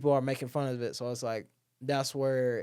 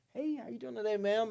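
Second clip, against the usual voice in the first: high, sugary fake-polite greeting; mock friendly greeting; 9 semitones higher than usual. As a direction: greet the staring stranger with overdone cheer. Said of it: "be like".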